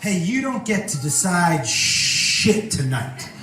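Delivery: lightly voiced tough woman; bossy low voice. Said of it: "her way of telling him".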